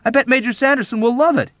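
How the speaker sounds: high, loud glee; soaring, emphatic confidence; enthusiastic. A narrator's reading. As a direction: crow it with gusto. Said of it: "Dunbar declared".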